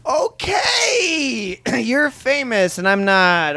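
very high strained mock outburst; exasperated. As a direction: squeal the okay, then drag out the rest. Acted reply to insulting celebrity.

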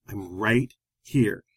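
very slow, low, each word spaced; volume-aware reminder. Retold or imitated. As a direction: imitate a pointed, drawn-out reminder. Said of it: "how aware she is of her volume".

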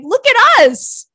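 high, loud, slow showy voice; mock attention-seeking company. Host mocking companies begging for attention, high and showy. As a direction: preen like a brand begging for attention. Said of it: "these companies are like".

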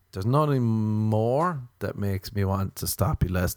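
normal talking voice

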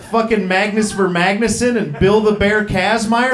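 ordinary talk

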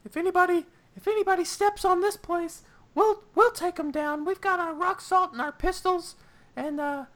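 very high squeaky voice; way up high, eager guard; Benry voice. Acted.

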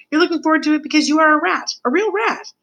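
high, quick, excited taunt; gloating rat mentor. Acted. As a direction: climb high with villainous delight.

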